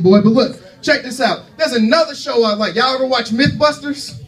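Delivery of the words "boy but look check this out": ordinary talk, nothing marked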